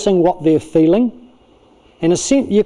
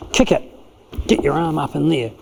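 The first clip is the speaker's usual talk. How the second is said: usual speaking voice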